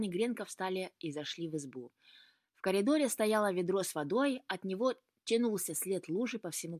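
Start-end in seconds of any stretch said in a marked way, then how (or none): none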